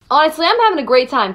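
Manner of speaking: normal talking voice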